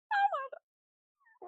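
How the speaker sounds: very high cartoon squeak; squeaky chipmunk voice. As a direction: pitch way up like a chipmunk. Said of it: "Wait, he goes".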